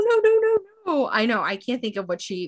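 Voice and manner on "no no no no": high, louder imitated sobbing no; hammed-up tearful denial; mock crying no. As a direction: plead through fake tears, high. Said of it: "she starts crying and she's like".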